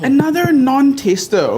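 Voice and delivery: high, slow mock despair; exasperated. Real, not acted. Judge despairs at another cook who didn't taste. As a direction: groan it out in disbelief.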